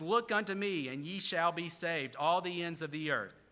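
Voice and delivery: ordinary delivery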